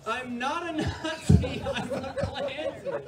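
slow sing-song mock of a proud klansman; mocking dumb klansman. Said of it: "he's like".

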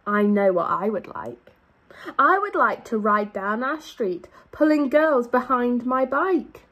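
normal talking voice

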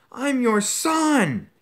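very high, loud, slow cry; anguished scream. Acted. Son crying out to his father in anguish, very high. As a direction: wail it, breaking with despair.

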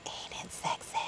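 breathy joking whisper about her foot; mock-seductive whisper; teasing whisper. Real, not acted. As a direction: whisper it like a naughty joke.

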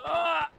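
sharp high scream of pain; man down shout. Real, not acted. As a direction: yelp as the bullet hits. Hit by gunfire, pained cry.

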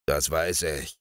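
low, grumbling growl; low sleepy ogre growling "Das weiß ich". Acted. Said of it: "knurrte der Menschenfresser".

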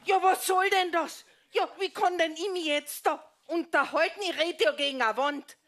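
high-pitched indignant protest; indignant complaint. Acted. Wife talking against a wall, high exasperated complaint.

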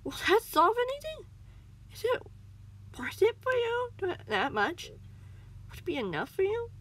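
high, strained, halting plea; nervous pleading. Acted. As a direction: plead high, stumbling over words. Cornered cat pleads for its life.

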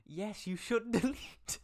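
high, loud laughing jab. Real, not acted. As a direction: laugh while telling them off. Laughing at the fanfic.

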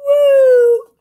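high, loud whoop; loud cheer. Acted. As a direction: whoop with joy. Cheering fish.

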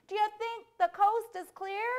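mother's shout. Acted. Mother shouting to check on the boy, high shrieked call. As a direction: holler it from another room in a high voice. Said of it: "my mom shouted".

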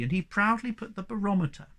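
plain reading voice

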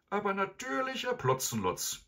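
high, sweet faux-polite; mock-innocent Kasperl. A narrator's reading. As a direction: sweetly play dumb. Kasperl voiced by reader.

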